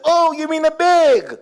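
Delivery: high mock American "Oh, you mean a bag?"; North American twang. Cashier finally understanding, in a mock American accent. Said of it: "She said".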